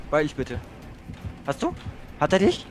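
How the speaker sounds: usual speaking voice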